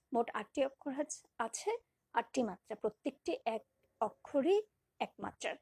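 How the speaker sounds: ordinary delivery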